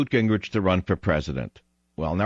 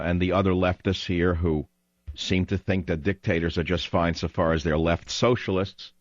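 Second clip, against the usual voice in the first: usual speaking voice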